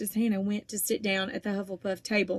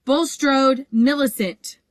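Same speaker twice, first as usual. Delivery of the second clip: calling out; 5 semitones higher, 6 dB louder, slower than usual. A narrator's reading. Teacher calling out names.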